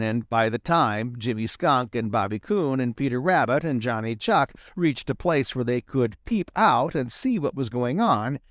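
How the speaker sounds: ordinary delivery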